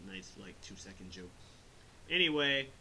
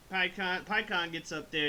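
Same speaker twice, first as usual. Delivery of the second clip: ordinary talk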